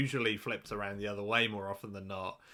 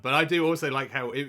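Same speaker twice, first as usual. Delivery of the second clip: normal talking voice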